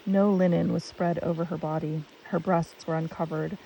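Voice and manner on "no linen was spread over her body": ordinary delivery, nothing marked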